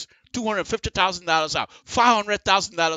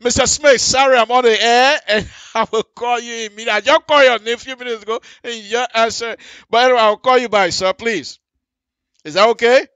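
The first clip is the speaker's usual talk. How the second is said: louder, hurried, talking into the phone live; 6 semitones higher, 8 dB louder, faster than usual. Real, not acted. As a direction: rush the caller off, loud and quick. Answering phone call on air.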